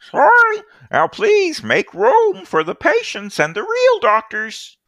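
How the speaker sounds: high, slow prissy official voice; snooty bureaucrat. Acted. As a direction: look down your nose at them in a high voice. GM voicing snooty bureaucrat NPC.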